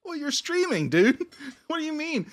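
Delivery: high-pitched exasperated retort; mock exasperation. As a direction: argue back in a high, incredulous voice. Mock argument with streamers.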